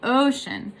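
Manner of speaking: "ocean" with the O drawn out and strong; stressed, then unstressed. Shown as a demonstration. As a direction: hit the first syllable of "ocean", let the second fall. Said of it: "So it's stressed".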